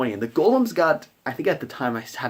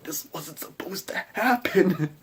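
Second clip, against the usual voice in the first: high, drawn-out mock dismay; comic, slow high-pitched protest; dismayed disbelief; 7 semitones higher, slower than usual. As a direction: stretch it out, pitch climbing in disbelief. Game master's retold dismay.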